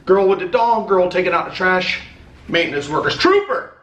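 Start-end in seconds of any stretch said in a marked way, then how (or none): 3.2-3.7 sudden high yell out the window; calling out. Real, not acted. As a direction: shout a name across the yard.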